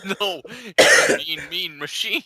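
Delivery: playful mock-sultry echo; teasing. Real, not acted. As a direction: tease the phrase back.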